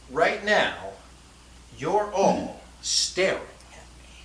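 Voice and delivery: "staring" set apart and punched; slow, deliberate take with weight on "staring"; stress on STARING. Shown as a demonstration. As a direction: pause after "all", then hit "staring". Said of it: "placed the emphasis on a different word".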